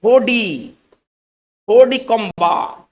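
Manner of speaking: होडी कोम्बा said slowly and firmly, stress on होडी; French pronunciation. Shown as a demonstration. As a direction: model होडी कोम्बा for the class. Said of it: "इसका जो उच्चारण हम पढ़ेंगे इस तरह से". (होडी कोम्बा धीरे और साफ़)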